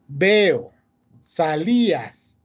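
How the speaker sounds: "Veo" and "salías" with the paired vowels in separate syllables; separated vowels. Shown as a demonstration. Teacher gives hiatus examples, separated vowels in "Veo" and "salías". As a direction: stretch "salías", the i apart from the a. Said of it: "Esas son las claves para identificar".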